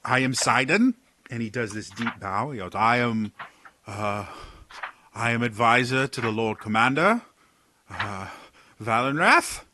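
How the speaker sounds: a rattled courtier tripping over his words; flustered advisor Sidon. Acted. Sidon nervously introduces himself to the party, stammering.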